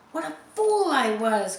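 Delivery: high loud dismayed cry; squire's cry. A narrator's reading. Squire learns of the mutiny and cries out in dismay. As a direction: cry out in self-reproach. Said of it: "cried the squire".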